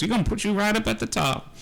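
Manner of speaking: put-on old woman promising the party's ribbon a top spot; old woman's voice. Old woman to the new adventurers, pleased. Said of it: "and she goes".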